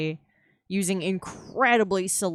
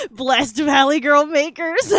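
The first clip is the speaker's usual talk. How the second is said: high, loud giggling through the words; laughing; 10 semitones higher, 11 dB louder than usual. Real, not acted. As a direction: crack up at yourself. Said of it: "laughing at own impression".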